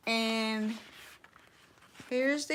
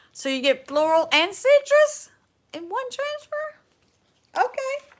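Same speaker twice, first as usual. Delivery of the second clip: delighted surprise; 12 semitones higher, 3 dB louder than usual. Real, not acted. Marvels at transfer's contents.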